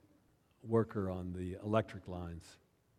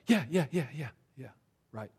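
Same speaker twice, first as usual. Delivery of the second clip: eager yeahs; 3 semitones higher than usual. Retold or imitated. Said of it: "He was all for that".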